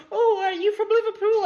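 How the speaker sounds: very high-pitched mock old lady; old woman voice. Old woman asking a stranger where he's from, mock high voice. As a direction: imitate a chatty old woman, pitched right up.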